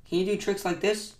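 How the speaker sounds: high-pitched showing-off question; lightly voiced show-off Toad; crying out proudly. A narrator's reading. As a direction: ask it high, at normal volume. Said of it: "cried Toad".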